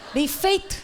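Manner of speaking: laughing echo. Shown as a demonstration. Preacher repeats mother's "Bem feito" to congregation, laughing high. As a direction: relish the mother's line, laughing. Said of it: "Aquele jeito de mãe".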